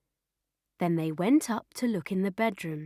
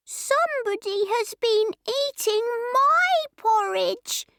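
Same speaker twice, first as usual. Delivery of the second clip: very high, squeaky little bear voice; high-pitched little cry of complaint; squeaky Baby Bear; 13 semitones higher than usual. A narrator's reading. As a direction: squeal it like a tiny bear cub. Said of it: "he cried in his squeaky little voice".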